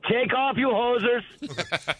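slow, drawn-out mock Canadian accent; a bad Canadian accent, played for laughs. Caller greeting the radio hosts in a bad Canadian accent. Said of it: "That's the worst accent impersonation I've ever heard".

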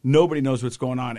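normal talking voice

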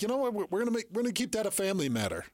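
lightly put-on high-pitched reply; Patrick Mahomes. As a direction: retell his answer with the pitch lifted. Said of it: "He's like".

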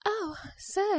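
put-on breathy high Southern Belle accent. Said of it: "a Southern Belle accent".

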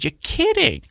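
jumps way up in pitch in stunned disbelief; retold disbelief. Retelling the coach's call, stunned high-pitched disbelief. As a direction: squeak it out like he can't believe it.

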